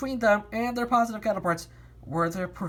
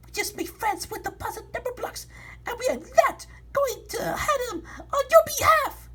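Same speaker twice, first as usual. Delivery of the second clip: high defiant voice. Acted. Defiant character continues.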